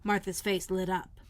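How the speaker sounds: ordinary delivery, nothing marked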